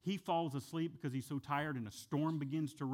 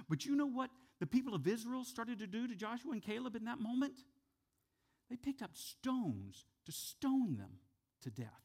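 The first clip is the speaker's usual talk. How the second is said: ordinary talk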